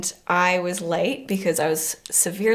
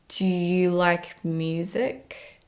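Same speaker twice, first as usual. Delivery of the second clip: very slow and softer, each word drawn out; slow, soft question; 5 dB quieter, much slower than usual. Retold or imitated. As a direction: drawl it gently, like a shy first line. Gary's first words retold.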